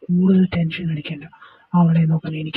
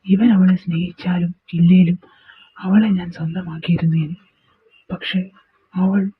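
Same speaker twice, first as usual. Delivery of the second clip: ordinary talk, nothing marked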